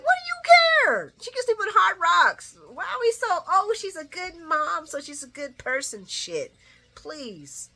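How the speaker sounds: high, loud scoffing rant; indignant rant. Real, not acted. Vlogger ranting back at the TV. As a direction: fire it back in disbelief. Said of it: "I'm like".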